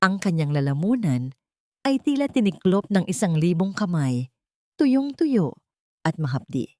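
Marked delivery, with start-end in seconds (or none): none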